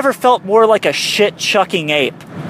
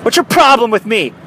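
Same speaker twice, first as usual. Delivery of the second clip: hostile confrontation; 6 semitones higher than usual. Retold or imitated. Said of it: "programmed into your tone of voice".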